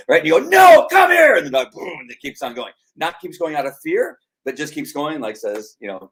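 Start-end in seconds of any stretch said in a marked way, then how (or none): 0.5-1.4 loud, high command; acted owner yelling for the dog; calling the dog. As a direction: call sharply after a runaway dog. Owner calling disobedient dog back.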